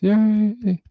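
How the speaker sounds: high, bright little yay; excited cheer. Real, not acted. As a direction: cheer her on. Cheering a viewer's success.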